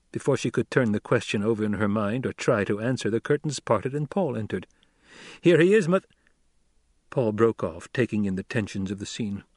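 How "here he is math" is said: bright raised greeting cut off; eager call. Acted. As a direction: call out cheerfully, then stop. Paul enters, calls out.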